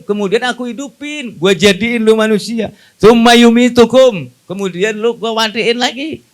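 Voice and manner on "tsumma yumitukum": slow, loud recited Arabic. Acted. Preacher declaims the verse to the congregation, loud and slow.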